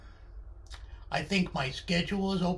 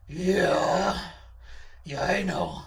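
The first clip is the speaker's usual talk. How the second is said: accusing character voice, building loud; Charlie's menacing voice; 5 dB louder than usual. Acted. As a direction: press the grievance with mock menace. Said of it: "that voice does sound menacing".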